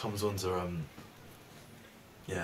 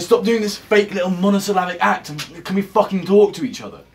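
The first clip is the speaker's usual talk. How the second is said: very loud, high-pitched screaming at her; furious yelling; 9 semitones higher, 17 dB louder than usual. Acted. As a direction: let it explode, full-volume rage.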